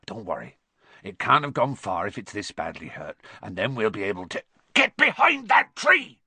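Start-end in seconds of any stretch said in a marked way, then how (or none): 0.0-0.6 low, softened voice; whispered reassurance. A narrator's reading. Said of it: "Hagrid whispered". 4.7-6.2 sudden high, loud yell; urgent warning shout. Acted.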